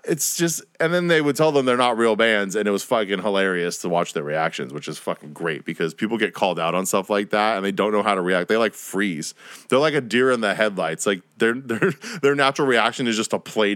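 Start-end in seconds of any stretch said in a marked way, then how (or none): none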